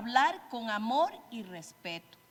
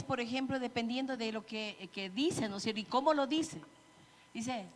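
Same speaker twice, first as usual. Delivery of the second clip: usual speaking voice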